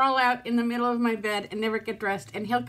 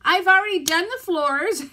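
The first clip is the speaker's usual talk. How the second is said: higher and louder tearful cry; 8 semitones higher, 8 dB louder than usual. Real, not acted. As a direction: wail it through tears. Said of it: "sit here and cry on camera".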